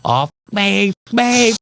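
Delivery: high, strange mock possessed voice; a hammed-up possessed figure from the film. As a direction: croak it like a possessed movie character. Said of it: "the possessed York go".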